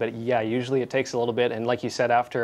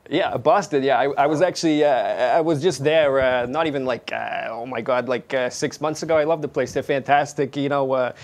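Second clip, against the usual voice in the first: Boston accent; 3 semitones higher, 5 dB louder than usual. Shown as a demonstration. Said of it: "you even do Boston".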